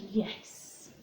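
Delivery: soft whispered little yes. Acted. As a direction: breathe the yes shyly. Little Bear replies.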